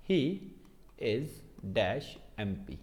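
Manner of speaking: each word spaced out slowly, dropping soft; slow dictation. Shown as a demonstration. Teacher dictating the example sentence slowly, word by word. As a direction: read the sentence off the board word by word. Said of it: "जैसे मैंने लिखा".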